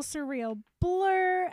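loud, high, long drawn-out wail; dramatically drawn-out exasperation; overwhelmed exclamation. Retold or imitated. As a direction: stretch it out in mock overwhelm. Acted overwhelmed retelling of school day.

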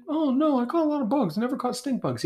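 imitating other people. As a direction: play an unsuspecting stranger. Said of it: "I like to ask people".

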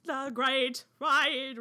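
slow robotic announcer voice; drawn-out robot ride announcement. Pretend. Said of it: "I don't wanna hear this robot voice".

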